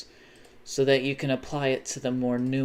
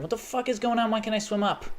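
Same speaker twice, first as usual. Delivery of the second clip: startled; 10 semitones higher, faster than usual. Real, not acted. Sudden alarm while gaming.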